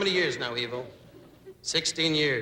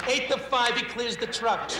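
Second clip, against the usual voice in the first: higher, slow bookie odds call; 7 semitones higher, slower than usual. Retold or imitated. Italian gambler giving odds on the jump, raised pitch. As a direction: lay the odds like an eager gambler. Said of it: "the Italian's going".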